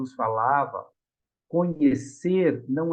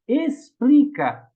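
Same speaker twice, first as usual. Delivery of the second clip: Explica slow, higher and louder; slow stressed Explica; 7 semitones higher, 6 dB louder, much slower than usual. Shown as a demonstration. Preacher spelling out Explica to the congregation, slow and stressed. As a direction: lean hard on Explica, drawn out. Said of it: "você tá dizendo".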